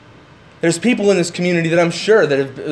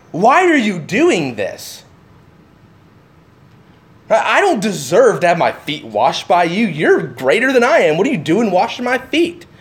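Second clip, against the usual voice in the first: ordinary delivery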